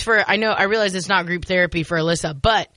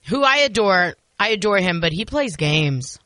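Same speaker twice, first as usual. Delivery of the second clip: ordinary talk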